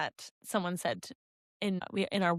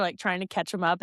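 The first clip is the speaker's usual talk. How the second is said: ordinary delivery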